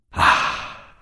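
breathless, drawn-out ah of dread; long faint ah of horror. A narrator's reading. Franco reading the letter lets out a faint gasp of horror. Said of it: "lungo, profondo e fioco".

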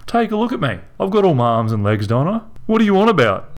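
can't believe what he hears; incredulous young man. A narrator's reading. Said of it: "asked John incredulously".

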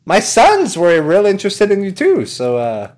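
high, loud salesman voice; Tom Nook. Acted. Salesman adding interest, high sly patter. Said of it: "and he's like".

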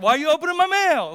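high, loud mock outburst; hammed-up teenage yell at mom; indignant shout. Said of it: "It was probably like".

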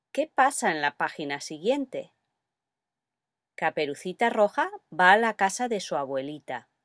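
ordinary delivery

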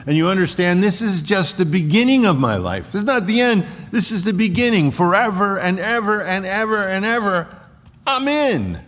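building to a high, loud preaching climax; rising fervor. Real, not acted. Preacher swelling with joy before the congregation. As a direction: lift them up with the promise of forever.

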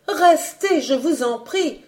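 put-on high, urgent plea from a young woman; pleading. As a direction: beg him to stay. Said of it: "suppliait Colette". (Colette supplie Bellegarde de rester, voix aiguë suppliante)

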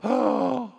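big hammed-up intake of breath; horrified gasp. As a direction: gasp at a dreadful threat. Reacts to bathtub threat in story.

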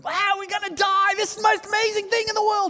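high, loud excited shout, fast at the end; Steve-O impression. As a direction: yell in wild awe like a stunt show host. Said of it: "doing my Steve-O".

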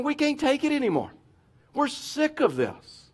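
high, dragged-out mock whine; whiny. Preacher voicing Israelites sick of manna, whiny. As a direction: moan it high like they've had enough. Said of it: "they're whining and they're like".

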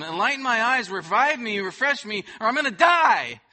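loud, pleading cry for help; desperate plea. Acted. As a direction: beg God out loud, rising to a cry. Preacher voicing David's desperate plea.

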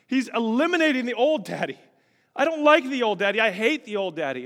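loud, high, driving preacher's fervor; impassioned denouncing. Retold or imitated. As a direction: build to an emphatic renunciation. Vehemently renounces old self to daughter.

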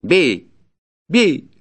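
loud, high cry of defiance; ferocious voice. Acted. Peasant dares the forester to beat him, ferocious. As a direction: dare him savagely to strike. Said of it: "подхватил мужик свирепым голосом".

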